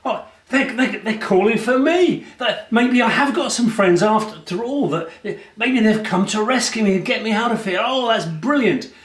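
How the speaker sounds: high, eager and delighted; hopeful excitement. Acted. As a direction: brighten up, thinking friends have come. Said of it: "Barabbas thought".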